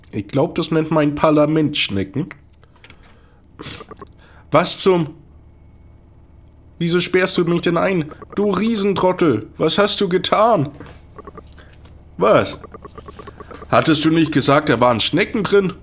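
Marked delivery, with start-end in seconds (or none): 4.5-15.7 mock outrage. Locked-in doctor scolding John, higher mock outrage. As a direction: tell him off for locking you in.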